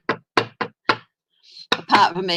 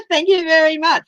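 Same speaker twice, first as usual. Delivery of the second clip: laughing; 9 semitones higher, 4 dB louder than usual. Real, not acted. Thanked after a compliment.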